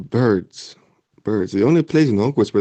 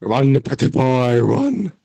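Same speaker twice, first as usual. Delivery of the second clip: hammed-up command to run; playful urging; 3 semitones higher, much slower than usual. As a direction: shoo him off in a mock voice.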